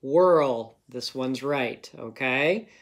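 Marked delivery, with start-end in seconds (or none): 0.0-0.8 whirl said loudly, as a spelling word; name the answer whirl clearly and loudly; dictation word. Shown as a demonstration. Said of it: "which one looks right?".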